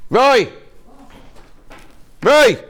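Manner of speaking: loud call across the room. Real, not acted. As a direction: shout his name to fetch him. Calling out to Roy across studio.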